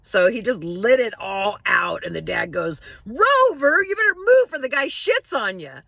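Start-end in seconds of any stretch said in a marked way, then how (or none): 3.1-5.8 louder, much higher alarmed call to the dog; dad warning dog. Retold or imitated. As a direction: yell a warning across the room. Dad warns the dog, punchline.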